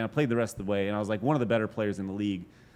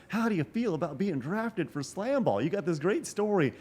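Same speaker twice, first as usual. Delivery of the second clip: higher, chirpy imitation of an interviewer; 9 semitones higher than usual. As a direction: put on the upbeat documentary interviewer. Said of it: "They're like".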